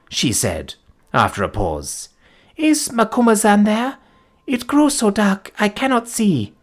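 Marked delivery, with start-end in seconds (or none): 2.6-6.5 put-on high, faint young woman's voice; dying young woman. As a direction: speak weakly as she dies. Dying Folata's voice.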